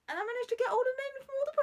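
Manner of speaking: excited. Real, not acted. Delighted at fitting them all.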